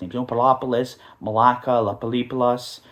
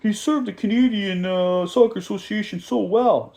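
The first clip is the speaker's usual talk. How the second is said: higher mock-awed voice; mocking impressed fans; 7 semitones higher than usual. Mocking fans who praise Bobby, higher awed voice. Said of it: "everyone's like".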